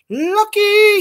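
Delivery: very high drawn-out squeal; playful falsetto jealousy; mock envy. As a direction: tease a friend with squeaky, put-on envy. Playful envy of friend's fan mail.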